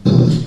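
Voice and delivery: a loud, mimicked boom of a kicked door; door kick noise. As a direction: punch out a breathy door bang. Imitating a door being kicked.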